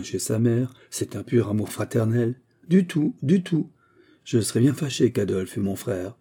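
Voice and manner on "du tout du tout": high, slow protest; emphatic denial. A narrator's reading. Daughter rejects father's idea of brotherly love, high emphatic denial. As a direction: deny it brightly up high, drawing it out.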